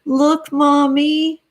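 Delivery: put-on child calling to mommy, slow and high; little child. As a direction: play a small child holding up a shell. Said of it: "a little baby would pick up this beautiful shell and say".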